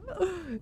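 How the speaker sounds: soft, high intake of breath; excited gasp. Real, not acted. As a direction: gasp in delight at the idea. Excited by the dinosaur museum.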